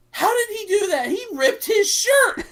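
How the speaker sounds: high, loud mock astonishment; imitating Etika. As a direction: act out a stunned outburst, stretching the last words. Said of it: "And E-, Etika's like".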